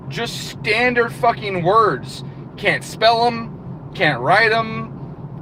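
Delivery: slow, high, every word hammered; slow emphatic rant. Real, not acted. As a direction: drag it out in disbelief. Ranting about bad emails.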